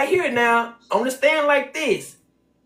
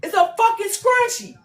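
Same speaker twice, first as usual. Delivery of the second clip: exasperated disbelief; 10 semitones higher than usual. Real, not acted. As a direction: pitch jumps way up in disbelief. Exasperated at scrunchie value.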